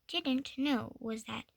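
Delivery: ordinary delivery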